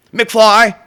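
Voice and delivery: mocking loud call of "McFly"; loud taunt. Real, not acted. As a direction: throw the name out like a schoolyard taunt. Taunting viewer, repeated.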